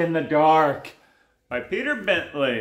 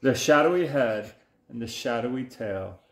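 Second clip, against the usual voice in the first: low, lingering build-up; hushed-to-ominous picture-book suspense; suspenseful story narration; 5 semitones lower than usual. A narrator's reading. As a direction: stretch it out toward a big reveal. Builds suspense reading to child.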